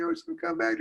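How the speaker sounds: high-pitched acted wail; crying mother. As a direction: wail pleadingly in a thin head voice. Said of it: "the mom was crying".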